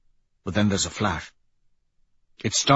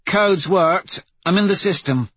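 higher-pitched crew member's status update; Pebble, focused hacker; 9 semitones higher, 4 dB louder than usual. Acted. Pebble updating Severo from the datapad, reporting. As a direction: report progress in a raised character voice. Voices Pebble hacking the system.

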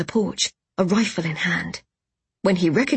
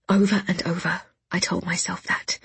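usual speaking voice

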